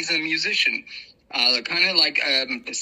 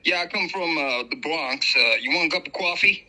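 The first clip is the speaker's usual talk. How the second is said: put-on Bronx accent; New York accent. Shown as a demonstration. Guest demos American accents on request, New York accent. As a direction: talk like a New Yorker from the Bronx. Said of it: "Okay, you could do New York".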